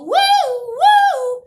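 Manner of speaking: high singsong woo. Pretend. Kid on porch mimicking her yo-yo, high singsong. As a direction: mimic the yo-yo spinning. Yo-yo sound effect.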